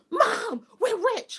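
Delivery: put-on gasp, then high, loud kid excitement; amazed child. Little girl finding twenty dollars tells mom, amazed and thrilled.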